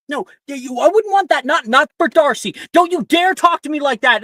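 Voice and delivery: high, loud rebuke; angry retort. Acted. Parent rebuking the other, high furious retort. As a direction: snap back furiously.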